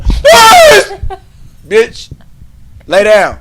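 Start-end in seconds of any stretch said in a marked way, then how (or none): 0.0-0.9 very high loud yelp of a word; karate yell. Retold or imitated. Showing off his breaking sound to the class, piercing yell. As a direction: scream police as a kiai.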